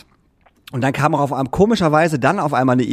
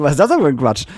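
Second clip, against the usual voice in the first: louder mock-indignant question; retold indignant protest; 7 dB louder than usual. Customer questions clerk about two company names, indignant. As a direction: protest loudly, retelling the argument.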